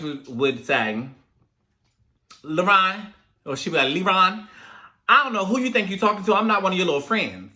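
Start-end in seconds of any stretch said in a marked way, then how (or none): 2.4-3.1 high, loud calling of the name; scolding mom call. Retold or imitated. Mimics mother calling out sternly. 3.9-7.5 high, loud, rapid-fire telling-off; scolding mom. Retold or imitated. Mimics mother scolding a mouthy child.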